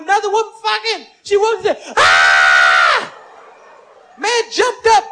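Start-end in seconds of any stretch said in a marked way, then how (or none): 2.0-3.1 shocked scream. Acted. Wife screams at discovery.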